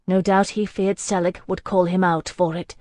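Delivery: ordinary delivery, nothing marked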